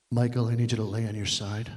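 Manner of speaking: low, hushed creepy doctor voice; creepy quiet doctor. Retold or imitated. Doctor prepping patient, low and creepy. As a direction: make the doctor sound unsettling. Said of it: "he says it kinda quietly".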